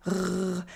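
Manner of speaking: "r" rolled softly at the back of the throat. Shown as a demonstration. Teacher demonstrating French R, soft uvular "r". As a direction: hold a soft gargled "r", tongue low and back. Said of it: "pronounced at the back of the throat".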